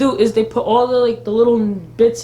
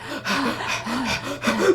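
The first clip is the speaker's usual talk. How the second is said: a sharp breathy gasp in, hammed up; mock gasp; 7 dB quieter than usual. Mocking the show's panting with a gasp.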